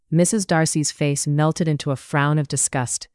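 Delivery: plain reading voice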